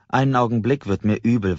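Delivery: plain reading voice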